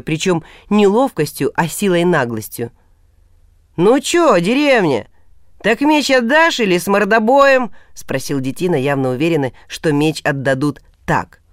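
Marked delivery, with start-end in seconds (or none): 3.8-5.1 cocky thug. Acted. Thug shakes down hero. 5.6-7.7 swagger it like a street bully; cocky thug taunt. Acted. Thug demands the sword.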